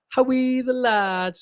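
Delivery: high, drawn-out Sunderland cheer; attempted Sunderland accent. Shown as a demonstration. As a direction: push the Mackem cheer way up high. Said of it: "Is there a difference there?".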